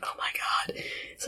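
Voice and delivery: hushed, voiceless whisper; whispered aside. Retold or imitated. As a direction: whisper it under your breath in mock dismay. Sam's embarrassed aside, acted.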